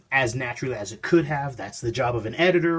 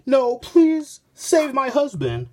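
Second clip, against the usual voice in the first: high, pleading woman's voice; mock woman begging for husband; 12 semitones higher, 4 dB louder, slower than usual. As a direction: beg in a high desperate voice. Woman begging hero in comic.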